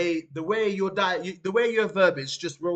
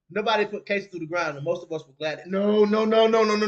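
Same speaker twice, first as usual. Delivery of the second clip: normal talking voice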